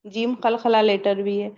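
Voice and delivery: normal talking voice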